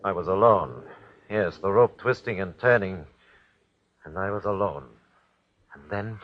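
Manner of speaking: normal talking voice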